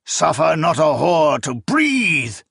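slow gleeful recited scripture. A narrator's reading. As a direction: savour the curse with glee. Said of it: "his tone now gleeful".

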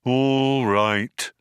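long, level, put-upon drone; gloomy, martyred compliance; resigned robot. A narrator's reading. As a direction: give in with heavy reluctance. Depressed robot gives in grudgingly.

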